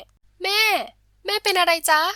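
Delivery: high, loud cry of concern; alarmed. A narrator's reading. Daughter startled by mother's coughing fit, alarmed. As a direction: call out in sudden alarm for mother. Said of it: "มะลิตกใจ".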